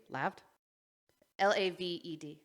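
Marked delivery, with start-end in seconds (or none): none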